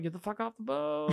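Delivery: put-on to departing drunk friends, soft and fed up; muttered dismissal. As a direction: grumble it under your breath. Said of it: "I'm like".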